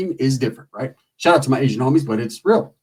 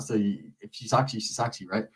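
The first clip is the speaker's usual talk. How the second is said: hushed aside; 6 semitones lower, 11 dB quieter than usual. Retold or imitated. Quiet aside voice.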